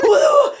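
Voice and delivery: freaked-out whoop. Retold or imitated. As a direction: let out a panicked whoop. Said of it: "like, freaking out".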